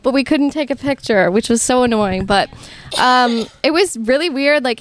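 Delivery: normal talking voice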